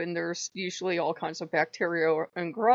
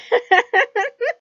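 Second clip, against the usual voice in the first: sudden high, loud burst of laughter; 11 dB louder than usual. Real, not acted. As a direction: crack up out loud.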